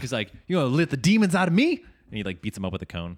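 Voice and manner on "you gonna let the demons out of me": high, loud, fast movie-quote rant; mock angry rant. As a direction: imitate a man worked into a frenzy. Acted film line, ranting man.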